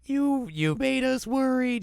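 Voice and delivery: high, slow mock-tearful voice; upset. Kagome upset at returning Inuyasha, high mock-tearful. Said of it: "And Kagome's very upset".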